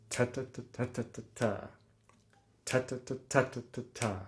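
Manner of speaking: soft "ta, ta, ta" in threes, first accented; rhythmic accents. Shown as a demonstration. Teacher showing how to think sixes without counting, rhythmic accents. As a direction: lean on each group's first "ta". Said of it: "making sure that the rhythmic accent is very clear".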